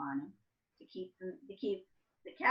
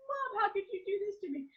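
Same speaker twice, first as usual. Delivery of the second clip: put-on high, whiny kid voice begging mom; pleading child. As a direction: beg mom like an eleven-year-old. Child devastated at haircut, retold.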